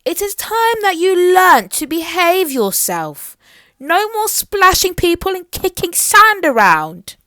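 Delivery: loud, high royal reprimand; stern queen. Acted. Mermaid Queen ordering Little Miss Trouble to behave: stern, loud scolding. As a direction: lay down the law to a naughty girl.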